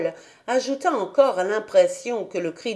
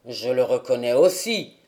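put-on lower, gruff old man; old grandfather; 8 semitones lower than usual. A narrator's reading. Grandfather answers Heidi about the flowers, lower old man's voice.

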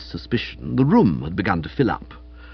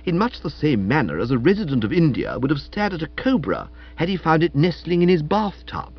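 plain reading voice

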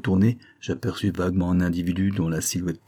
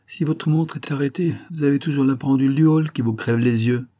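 high-pitched, pressing retort; insistent reproach; 6 semitones higher than usual. A narrator's reading. Miss Wilpert scolding her companion for lateness, insistent. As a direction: press the point in a higher acted voice. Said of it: "insista Miss Wilpert".

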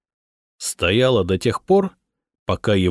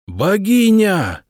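much higher, louder, slow wail; 11 semitones higher, 4 dB louder, much slower than usual. A narrator's reading. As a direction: howl it out in grief. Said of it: "завыло оба войска".

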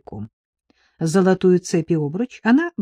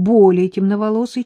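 plain reading voice

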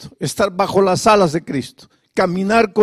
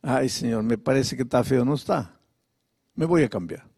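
soft casual prayer; 7 semitones lower, 8 dB quieter than usual. Retold or imitated.

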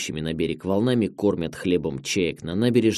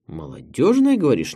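high, delighted echoing question; sudden delight; 6 semitones higher, 3 dB louder than usual. A narrator's reading. Director hearing it is about youth, suddenly delighted. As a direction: perk up at the word, pitched well up. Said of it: "неожиданно радуется директор".

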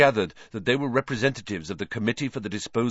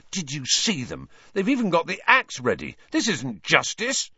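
angry; 7 semitones higher than usual. A narrator's reading. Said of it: "said Ron angrily".

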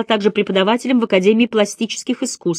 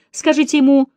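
sulky, petulant demand; like a spoiled child not getting her way; ungracious, spoiled; 7 semitones higher than usual. A narrator's reading. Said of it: "голос звучал на этот раз очень немилостиво". (капризно, как избалованный ребёнок)

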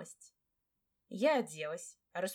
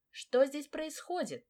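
slow, higher-pitched stern demand; mild bark; 6 semitones higher, slower than usual. A narrator's reading. Mistress confronts maids fighting in her bedroom, mild bark. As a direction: demand answers with a restrained bark. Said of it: "Рявкнула, тщательно откашлявшись".

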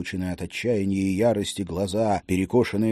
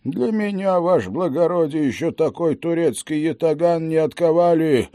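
higher, hearty boastful voice; brave old Cossack; 8 semitones higher, 4 dB louder than usual. Acted. Wounded Cossack cheering his officer, hearty bravado.

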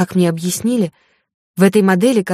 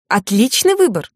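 bright chirpy shopgirl, a bit higher; chirping; 4 semitones higher than usual. A narrator's reading. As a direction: chirp it out like a cheerful saleswoman. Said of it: "прощебетала альвежанка".

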